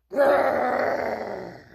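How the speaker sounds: loud, high mock growl; bear growl. Bear growling at Donovan, loud mock growl. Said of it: "growled at Donovan".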